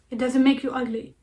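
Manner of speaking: ordinary talk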